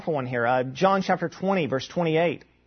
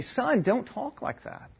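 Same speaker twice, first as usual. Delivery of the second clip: higher, slower parental reproof, acted; correcting father; 6 semitones higher, 4 dB quieter, slower than usual. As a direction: gently play a dad correcting his son. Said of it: "I would come there and say".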